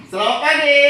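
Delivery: "Selamat pagi" called out high and loud; called greeting. Shown as a demonstration. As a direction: sing out the greeting to the class. (salam "Selamat pagi" diserukan tinggi dan keras)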